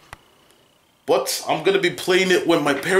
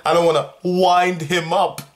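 repeating louder; 5 semitones higher, 3 dB louder than usual. Retold or imitated. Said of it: "I said".

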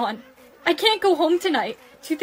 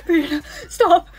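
louder, laughing and crying at once; crying pleas; 5 dB louder than usual. Acted. Friend begs Brianna to stop laughing, sobbing and laughing. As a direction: beg her to stop through tears and laughter.

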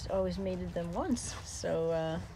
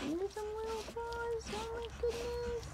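soft cooing; 13 semitones higher, 8 dB quieter than usual. Real, not acted. Cooing over the puppy's little paws, high and soft. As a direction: melt over the tiny paws.

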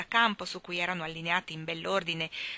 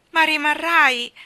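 much higher, loud and slow plea; insistent, heartfelt; 9 semitones higher, 14 dB louder, slower than usual. A narrator's reading. Jane pressing Mary Poppins to stay, loud pleading. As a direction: press her, pleading with all your heart. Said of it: "insistette Jane con calore".

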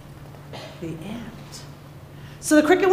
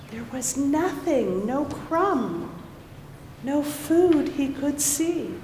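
ordinary delivery, nothing marked

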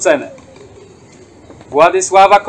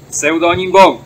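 normal talking voice

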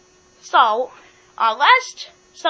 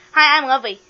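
ordinary talk

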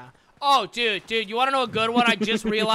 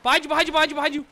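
urgent warning; 6 semitones higher, 5 dB louder, much faster than usual. Real, not acted. Warning teammate.

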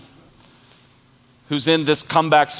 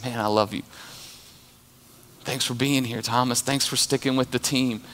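soft, lower, tender reassurance; tender Jesus; 3 semitones lower, 4 dB quieter than usual. Retold or imitated. Jesus comforting doubting Thomas, soft and tender. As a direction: speak gently to a doubting friend. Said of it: "He said".

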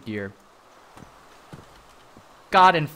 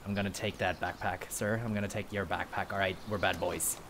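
a lower, softer menacing robber voice; low tough-guy voice; 8 semitones lower, 10 dB quieter than usual. Acted. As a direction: play the stickup man, low and quiet. Robbing a player at gunpoint.

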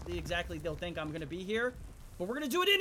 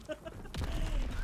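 jump scare laugh; 5 semitones higher, 5 dB quieter than usual. Real, not acted.

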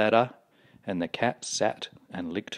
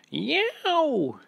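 very high animal yowl; yelled yowl. A narrator's reading. Duck hating the lily pond, yowling. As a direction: yowl like an offended cat, up high. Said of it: "it yelled".

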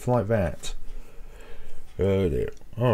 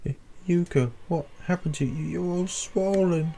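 high, trembling questions; frightened; 8 semitones higher than usual. Real, not acted. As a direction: shaken by what you see. Frightened at friend's state.

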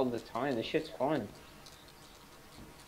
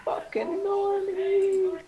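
very high, slow, squeaky sneer; squeaky mocking voice; 4 dB louder, much slower than usual. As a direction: drag it out in a silly high voice.

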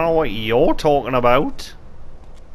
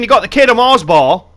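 indignant; 8 semitones higher, 10 dB louder than usual. Real, not acted. Complaining about Easter bunny.

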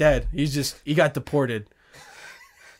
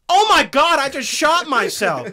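much higher, louder, slowed panicked gasp; mock shock; 9 semitones higher, 5 dB louder than usual. Friend mimicking a man who shot himself, mock shock. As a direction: play the stunned gunshot victim, hammed up. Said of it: "when they do go into shock".

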